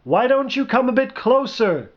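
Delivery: high-pitched call across distance. A narrator's reading. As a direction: call to someone far away. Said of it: "he called".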